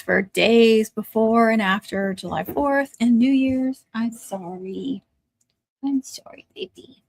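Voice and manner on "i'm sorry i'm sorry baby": soothing. Real, not acted. Soothing dog during fireworks.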